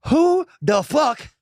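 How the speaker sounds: high, drawn-out disbelief; mock-offended character voice, climbing high; indignant character. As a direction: slowly demand it in a put-on, affronted voice. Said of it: "What the fuck is this character you're doing?".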